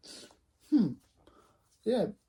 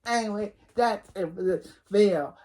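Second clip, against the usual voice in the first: ordinary talk, nothing marked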